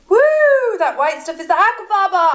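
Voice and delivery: high, whooping reveal; whoop, then announce it high and proud; excited whoop. Pretend. Shows the whipped result.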